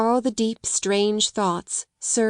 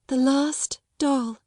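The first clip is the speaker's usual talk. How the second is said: slower, higher mournful repeat; mournful echo; 6 semitones higher, slower than usual. A narrator's reading. Grieving girl repeats her father's last gift, mournfully. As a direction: echo the words even more sadly. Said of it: "her little mournful voice had an odd sound".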